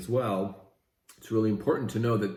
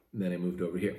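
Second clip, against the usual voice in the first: ordinary talk, nothing marked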